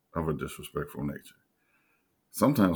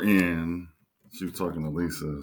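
ordinary talk, nothing marked

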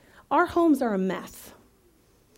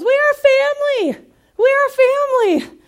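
yelled cheer; 13 semitones higher, 10 dB louder than usual. Retold or imitated. Niece yelling to gather family, loud cheer. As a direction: yell it out like an excited little girl. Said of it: "she yells".

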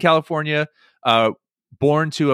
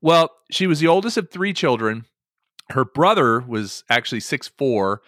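normal talking voice

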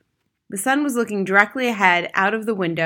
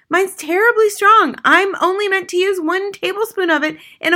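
high, loud exasperated scolding; mother crying out; 13 semitones higher, 8 dB louder than usual. A narrator's reading. Mother berates Harry for his stupidity, crying out. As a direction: scold your husband high and forcefully. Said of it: "the mother cried".

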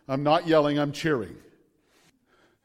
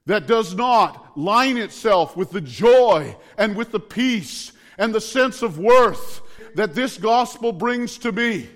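raised, emphatic sermon voice with punched phrases; full-force preaching; 5 semitones higher, 5 dB louder than usual. Real, not acted. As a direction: proclaim it boldly, driving each repetition home.

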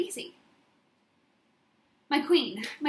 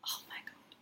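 breathless whisper; whispered aside after a laugh; 16 dB quieter than usual. Real, not acted. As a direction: whisper it like a secret.

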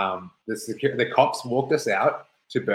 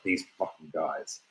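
low, slow, quieter grumble; exasperated aside; 5 semitones lower, 9 dB quieter, slower than usual. Retold or imitated. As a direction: lightly imitate a friend's weary disbelief. Friend shaking head at arrest.

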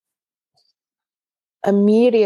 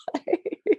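laughing; 12 semitones higher, 6 dB quieter than usual. Real, not acted. Laugh after retold reply.

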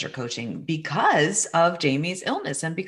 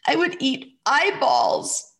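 put-on a small boy's voice, much higher, pleading through tears; child through tears; 12 semitones higher than usual. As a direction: voice your son as he cries and begs. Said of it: "he said through tears".

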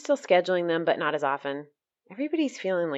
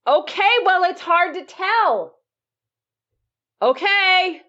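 calling out; 12 semitones higher, 7 dB louder, slower than usual. Real, not acted. Calling back to dad.

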